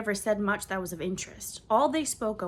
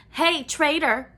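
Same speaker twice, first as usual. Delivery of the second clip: put-on a boy's loud, slow jeering yell, higher; demanding shout; 8 semitones higher, 10 dB louder, slower than usual. A narrator's reading. Boy jeering at the newcomer, loud demanding shout. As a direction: shout the insult across the hall. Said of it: "a boy demanded".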